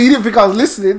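ordinary talk

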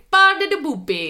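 bouncy cartoon Italian; mock Italian accent. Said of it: "learning accent stereotypes".

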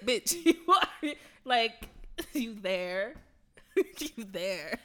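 slow words broken by laughter; laughing disbelief. Retold or imitated. Friend retells line at the polls, slow and laughing. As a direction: laugh through the disbelief, slowly. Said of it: "I was like".

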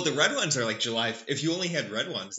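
ordinary delivery

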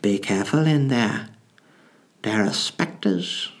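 slow, put-on eerie warning; spooky neighbour voice. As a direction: play the neighbour giving an ominous warning. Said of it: "She went".

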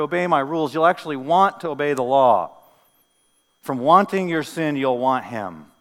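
loud, emphatic scripture proclamation; forceful preaching. Acted. Preacher proclaiming the promise of a new heart, forceful preaching.

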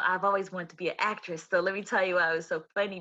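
usual speaking voice

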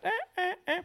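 high duck quacks; fine hen call. Shown as a demonstration. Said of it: "that fine hen".

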